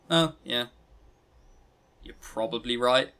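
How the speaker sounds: normal talking voice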